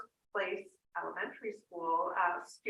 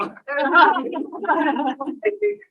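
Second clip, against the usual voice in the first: a burst of real laughter, much louder; 7 semitones higher, 13 dB louder than usual. Real, not acted. Laughing at herself while finding her glasses on stage, loud laugh. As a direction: laugh out loud at your own fumbling.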